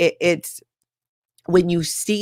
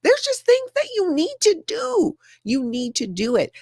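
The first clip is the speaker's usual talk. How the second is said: high-pitched mock scolding of the building owners; calling them out; 10 semitones higher than usual. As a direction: pitch climbs into an exaggerated plea. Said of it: "calling them out on the fact".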